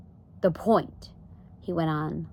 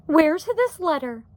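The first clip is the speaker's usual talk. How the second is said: high, loud question, mildly urgent; a raised, slowed demand; exclaiming; 11 semitones higher, 6 dB louder, slower than usual. A narrator's reading. Wife asks to see the letter, exclaiming. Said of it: "exclaimed Homley".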